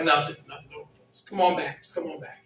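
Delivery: soft, low, brushing it off; imitating a woman hushing things over; coaxing. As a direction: coax him back quietly. Woman coaxing him back in story.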